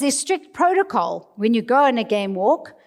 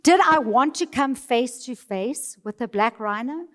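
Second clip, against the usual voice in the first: ordinary talk, nothing marked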